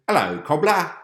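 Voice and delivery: loud, high Cockney call; Cockney accent. Acted. Onlooker greeting the man they take for the cobbler, loud Cockney. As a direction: hail him in a put-on Cockney voice. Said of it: "speaking in a cool Cockney ac-, accent".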